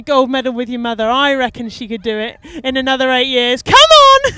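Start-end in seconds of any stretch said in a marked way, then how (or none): 3.6-4.3 big cheering shout, much higher and louder. Real, not acted. Commentator yelling to Bonnie from the boat, loud cheer. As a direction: yell it out to cheer her on. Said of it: "Sorry, I shouted".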